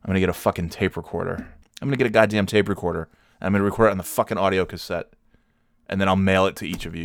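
normal talking voice

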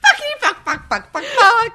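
very high, loud, laughing swearing; gleeful kid echo. Pretend. Co-host echoing the swearing child, high and laughing. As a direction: mimic a giggling kid's naughty chant.

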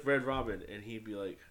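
ordinary talk, nothing marked

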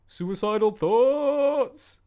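high, loud, drawn-out song introduction; stage announcement shout. Retold or imitated. Retelling how the singer introduced a dark song, hyped-up shout. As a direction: holler it slowly to a crowd, stretching the last word. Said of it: "I was introducing a song".

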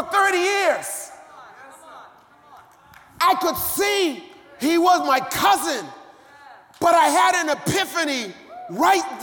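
high, shouted preaching at full force; fiery preaching. Acted. Pastor preaches loudly about God opening eyes. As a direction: drive it home to the congregation.

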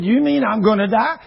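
can't believe he'll die; shocked question. Retold or imitated. Man shocked at death sentence.

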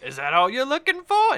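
high, loud teasing come-on; flirty winking woman. Acted. She winks at the newcomer, high and loud flirtation. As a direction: tease with a wink in the voice. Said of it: "she winks at you and then laughs".

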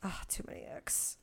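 breathy whispered aside. Real, not acted. Asked for her turn, she whispers her dismay. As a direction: whisper it to yourself, overwhelmed.

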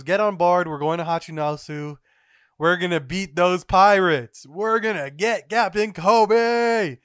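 hammed-up commander rousing the crew; rallying battle cry. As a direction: shout the orders, swelling to a war cry. Garp rallies crew, building.

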